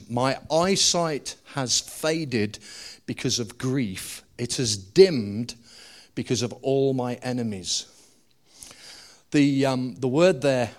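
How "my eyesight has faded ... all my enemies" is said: slow emphatic repeat. Shown as a demonstration. Preacher repeating the verse slowly for emphasis. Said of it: "Watch what he says".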